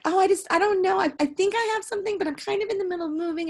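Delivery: high, fast, dithering voice of a hesitant dancer; flaky dancer impression. Mimicking the vague dancer to her friend, high and hurried.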